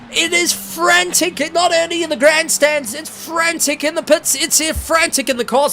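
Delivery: high, loud play-by-play of a frantic finish; excited race call. Real, not acted. Commentator calling the finish to viewers, excited. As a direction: call the last corner at full pitch.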